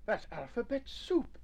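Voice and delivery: high-pitched exclamation; excited storybook exclamation; exclaiming. A narrator's reading. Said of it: "exclaimed Nubbet".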